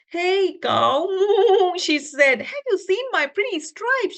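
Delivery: a high, pleading zebra voice; high zebra puppet voice. Acted. Zebra asks the cow about her stripes, high and pleading. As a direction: play the lost zebra asking the cow.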